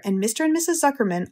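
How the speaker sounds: ordinary delivery, nothing marked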